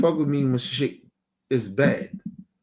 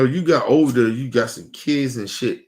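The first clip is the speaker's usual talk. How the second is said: normal talking voice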